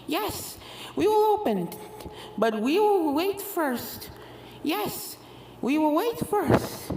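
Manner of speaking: sweet menace, drawn out; eerie ghost twins. A narrator's reading. Ghost twins telling Apple Bloom they will wait, sinister.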